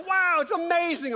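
high, loud mock amazement; over-the-top excitement. Daughter excited about Tupperware, over-the-top excitement. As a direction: exclaim it like an easily thrilled kid. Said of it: "she's excited about Tupperware".

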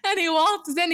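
talking through helpless high-pitched laughter; real laughing. Real, not acted. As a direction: crack up while trying to tell it. Still cracking up at the story.

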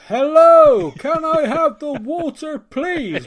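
loud, high, slow and over-enunciated; typical Englishman abroad. Acted. Englishman abroad asking a shopkeeper for water, loud and slow. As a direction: bellow it as if volume will translate it. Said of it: "doing the typical Englishman abroad".

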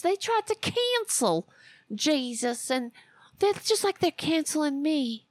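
high mocking preacher voice. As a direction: parody a self-pitying preacher. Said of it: "talk about how they're like Jesus".

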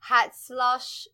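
Hatzlosh in a light German accent, harsh ts and sh. Shown as a demonstration. Guessing the Yiddish phrase, in a German accent. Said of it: "I'm just doing this in the German accent".

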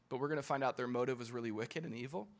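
ordinary delivery

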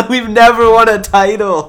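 high, loud, drawn-out denial; exasperated fan setting the record straight; indignant protest. Real, not acted. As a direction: protest loudly through a laugh. Indignant denial in sports argument.

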